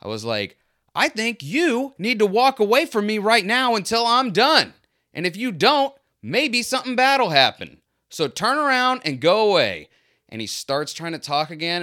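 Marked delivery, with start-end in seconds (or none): 1.0-9.9 retold yell, much higher and louder; screaming over him. Narrator screaming over the shop owner, retold furious yell. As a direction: shout him down with a threat. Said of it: "I just screamed over him".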